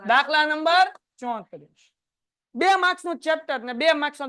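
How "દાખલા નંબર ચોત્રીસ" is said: slow, every word of "દાખલા number ચોત્રીસ" spaced out; stress on number. Shown as a demonstration. Teacher drilling the exam question number to students, slow and stressed. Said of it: "કેટલામો હશે?".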